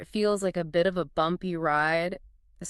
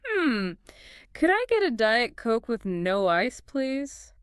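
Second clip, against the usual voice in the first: higher, polite passenger request; 5 semitones higher than usual. Shown as a demonstration. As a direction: ask sweetly for a drink. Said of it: "Passenger voice".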